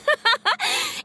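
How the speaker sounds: a high, pleased little laugh; bashful laugh. Acted. Director laughing when called cool by Paimon, pleased. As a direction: laugh off the praise happily.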